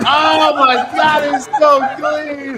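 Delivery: real delighted outburst. Real, not acted. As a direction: squeal at how clean it is. Reacting to clip, delighted.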